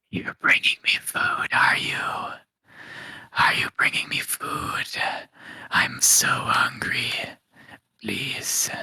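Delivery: eerie drawn-out whisper; slow, pleading, voiceless whisper; hungry whispering voice. Acted. Mysterious voice begging Keck for food, whispering. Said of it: "it's like a whispering".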